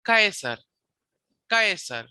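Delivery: "Caesar" said KAI-sar, first syllable stressed; hard K and "ai" in "Caesar", falling tune; Latin pronunciation. Shown as a demonstration. Teacher showing where the accent sits, "Caesar" as Latin KAI-sar. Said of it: "the accent is on Caesar".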